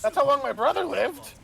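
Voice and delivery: high-pitched put-on character voice; voiced game character. Game character telling of his brother, high put-on voice. Graw's acted reply in retold scene.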